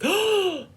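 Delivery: loud, high mock gasp; dramatic group gasp. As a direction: gasp in exaggerated shock. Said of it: "everyone gasped again".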